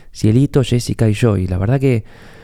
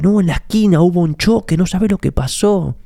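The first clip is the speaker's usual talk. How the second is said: gossipy excitement; 8 semitones higher than usual. Retold or imitated. Gossip relishing bad news.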